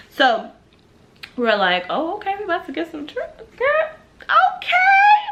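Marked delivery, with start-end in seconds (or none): 1.9-5.2 high squeal climbing at the end; hammed-up gleeful hype, rising higher and higher; excited girl talk. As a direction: hype up your friend in a high voice. Acts out friends' excitement at scoring.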